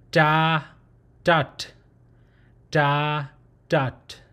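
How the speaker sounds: "Da" open then "dot" closed, each set apart by pauses; da and dot drill. Shown as a demonstration. Teacher drilling open and closed syllables "Da, dot". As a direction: contrast "Da" and "dot" slowly and clearly. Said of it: "memorize how this, uh, consonant open syllable sounds".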